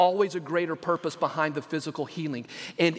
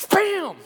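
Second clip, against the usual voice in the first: sudden loud, high "bam"; imitated bang; 11 semitones higher, 5 dB louder than usual. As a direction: burst out the sound of a violent swipe. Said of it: "and he does this".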